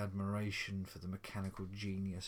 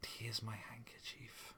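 soft whispered comfort; whispering; 2 semitones higher, 6 dB quieter than usual. A narrator's reading. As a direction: hush it gently to her. Husband soothes crying wife.

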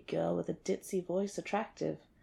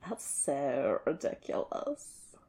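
usual speaking voice

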